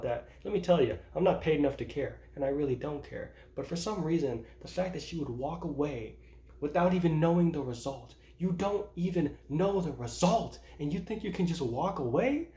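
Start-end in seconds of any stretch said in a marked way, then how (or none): none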